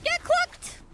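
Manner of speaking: high squealed taunt. Pretend. Taunting in chicken voice.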